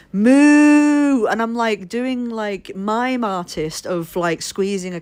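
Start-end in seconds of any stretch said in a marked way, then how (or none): 0.1-1.3 long, loud, high cow moo; imitated cow moo. Miming for milk to a baffled shopkeeper, cow moo. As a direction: bellow a drawn-out moo like a cow. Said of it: "I'm standing there and I'm going".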